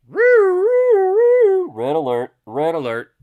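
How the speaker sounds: loud high siren wail into warning; imitated alarm. Trader sounding the alarm on the chart, imitated siren. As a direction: wail like a ship's klaxon, slow and loud.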